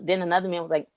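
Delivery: ordinary talk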